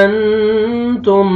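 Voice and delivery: very slow chanted अंतुम; drawn-out tajweed recitation; Quranic recitation. Shown as a demonstration. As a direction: stretch it out, reciting. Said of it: "आपने इख्फा नहीं करना".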